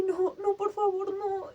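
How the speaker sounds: high, tearful begging; retold desperate pleading. Owner pleading over her dying dog, high tearful desperation. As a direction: plead against the loss, close to crying. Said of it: "con una desesperación horrible".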